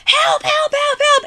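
very high, repeated panicky calls; mock cries for help. Host voices Ashley running to Christmas carolers, mock panicked calling. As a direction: imitate someone calling desperately for rescue.

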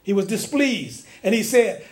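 ordinary delivery

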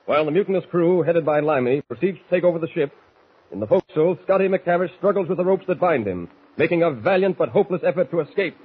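steady radio narrator voice; story narration. A narrator's reading. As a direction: set the scene for the listener. Narrator bridges between scenes.

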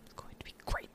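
very soft voiceless whisper; insistent whisper. Real, not acted. Fan answering a doubtful cohost, whispered. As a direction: whisper it under your breath, still convinced.

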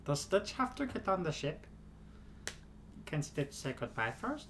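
soft pleading cartoon alien voice; Stitch impression. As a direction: beg sadly like Stitch. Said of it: "killer Stitch".

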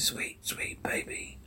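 distorted ghostly whisper. Acted. Daddy's warped voice luring Jane, whispered. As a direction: breathe it out, eerie and warped. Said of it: "Daddy distorted voice".

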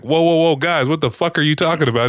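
high-pitched mock protest; Penguin. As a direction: act out a rattled captive protesting. Retold Penguin protesting to interrogators.

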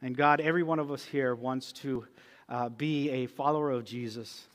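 soft, earnest prayer voice; closing prayer. Acted. Pastor leads the church in prayer, softly. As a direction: pray quietly over the congregation.

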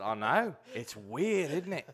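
put-on amused Australian accent, lifting at the end; chummy Aussie tag question. Retelling his answer to the musician, Australian accent. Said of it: "Australian accent really good".

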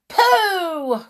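very high, loud disgusted "Pooh!"; crowd cry. Acted. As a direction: squeal "Pooh!" in glee. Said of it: "cried the audience".

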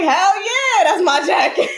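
high, loud laughing reply; laughing quote. Retold or imitated. To a friend over a jacket, laughing loudly. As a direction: laugh through the comeback to a friend.

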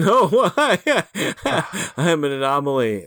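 laughing through the words. Real, not acted. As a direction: laugh while you answer. Laughs while answering.

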